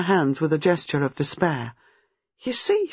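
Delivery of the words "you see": high, agitated outburst; high-pitched distress; despairing. A narrator's reading. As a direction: burst out in despair. Despairing gesture, believes herself mad.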